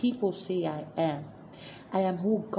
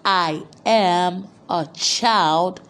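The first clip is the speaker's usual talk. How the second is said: loud and clear; 7 dB louder, slower than usual. Shown as a demonstration. Preacher shouting the declaration so the church repeats it, loud and clear. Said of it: "say this loud and clear".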